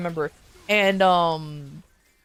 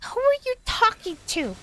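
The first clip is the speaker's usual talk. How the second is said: high suspicious mock girlfriend. Mocking the girlfriend demanding who her boyfriend talks to, high and suspicious. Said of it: "she's like".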